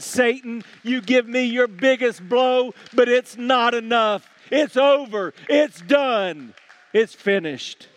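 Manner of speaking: high, loud, triumphant preaching; victorious shouted declaration, easing at the end; defiant proclamation. Acted. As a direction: hurl it at a beaten enemy. Jesus defies Satan, preached at full force.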